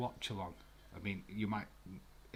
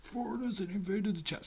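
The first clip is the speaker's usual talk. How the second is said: much higher and louder, gleeful cry; excited high squeal; 11 semitones higher, 5 dB louder than usual. Real, not acted. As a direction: squeal it out with delight. Playful announcing to chat.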